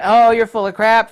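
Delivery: loud, high sneering scoff; mocking scoff. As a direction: jeer at him. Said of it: "They said".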